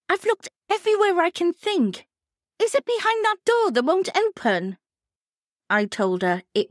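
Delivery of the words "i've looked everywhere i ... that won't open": high, harried friend voice; a flustered, searching friend; stressed. Retold or imitated. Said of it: "She sounded stressed".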